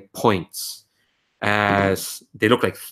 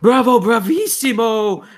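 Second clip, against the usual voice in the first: put-on much higher, loud old woman's cheer; high aged voice; 13 semitones higher, 5 dB louder than usual. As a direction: shout bravo like a thrilled old noble lady. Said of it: "kinda high, aged, tired voice just goes".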